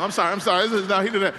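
ordinary talk

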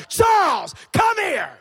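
put-on boy calling out his bully from afar. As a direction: shout his name across the yard. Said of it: "I called out".

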